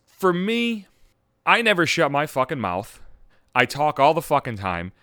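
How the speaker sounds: normal talking voice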